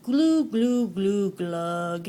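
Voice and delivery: fish mouthing glug glug; mouthed fish glugs; glugging fish. Acted. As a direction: burble like a singing fish. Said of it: "he mouths".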